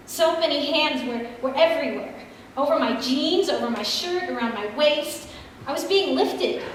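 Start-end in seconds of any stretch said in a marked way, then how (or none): none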